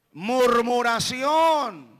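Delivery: slow, shouted single word. Acted. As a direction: hammer the word home. Predicador enfatiza con grito. (palabra gritada despacio)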